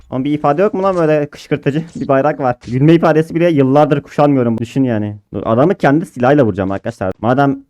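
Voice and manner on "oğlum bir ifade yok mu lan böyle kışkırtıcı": ordinary talk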